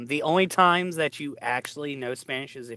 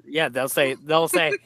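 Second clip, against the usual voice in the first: normal talking voice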